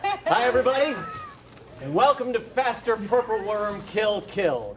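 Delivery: game show host. Acted. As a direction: greet the audience like a TV host.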